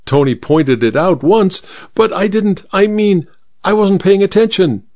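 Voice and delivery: lightly flustered, breaking off and restarting; flustered stammering. A narrator's reading. Vicar excusing himself to the housemaid, mildly flustered stammer. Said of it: "stammered the vicar".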